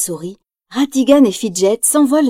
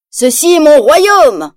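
higher, louder, slow triumphant claim; self-satisfied proclamation; 5 semitones higher, 5 dB louder, slower than usual. Acted. Ratigan presenting himself to the crowd, smug slow proclamation. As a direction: proclaim it like a new tyrant. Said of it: "dit Ratigan d'un air satisfait".

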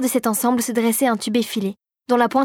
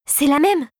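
higher, bright proclaiming; trumpeting; 5 semitones higher, slower than usual. A narrator's reading. Girl finds the matching lock, excited announcement. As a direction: announce the discovery proudly. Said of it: "claironna Clara".

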